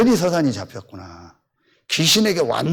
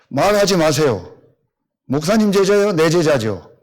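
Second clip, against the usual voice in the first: raised, louder telling-off; angry scolding; 4 semitones higher, 5 dB louder than usual. Retold or imitated. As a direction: snap at him like an offended teacher. Teacher scolds pastor angrily.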